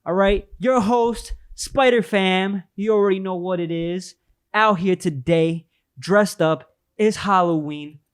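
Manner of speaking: high, slow hype host voice; show host intro. Acted. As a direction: announce the show like a big opening. Opens the show as host Spider Fam.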